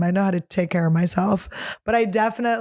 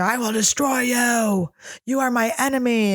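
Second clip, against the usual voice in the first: a retold screaming threat, stretched out; mock scream; 4 semitones higher than usual. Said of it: "He screamed at me".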